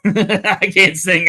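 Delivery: winded giggle after running; laughing, out of breath. Real, not acted. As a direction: laugh it out while gasping for air. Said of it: "I'm really out of breath".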